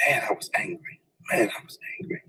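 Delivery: hushed, seething whisper, repeated; whispered anger. Real, not acted. Fired worker remembering his fury, whispering. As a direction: let the anger simmer under your breath. Recalling rage at being fired.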